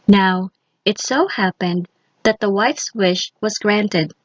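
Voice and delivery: usual speaking voice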